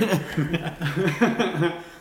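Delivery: a short high laugh, a little away from the mic; bright burst of laughter. Real, not acted. Woman laughing while telling her ghost story, high laugh.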